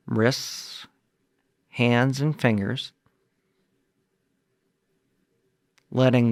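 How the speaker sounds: measured meditation guide, long pauses between phrases; guided meditation. Acted. Guide talks listeners through releasing body tension, guided meditation. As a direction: lead listeners through it phrase by phrase, leaving space to breathe.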